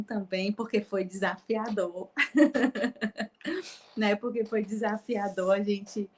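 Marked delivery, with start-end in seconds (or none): 2.1-3.7 bright laugh breaking out; short laugh. Real, not acted. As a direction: let a short laugh escape. Laugh alone.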